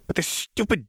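high, shrill angry snarl; feral snarling. Acted. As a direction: spit the curse like an animal. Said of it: "She snarled another curse".

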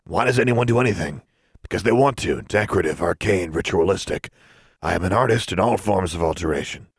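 grand, gruff artist's declaration; gruff character voice, self-important; gruff proprietor, indignant. Acted. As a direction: declaim like a proud shopkeeper. Story figure: proprietor declaims about his art.

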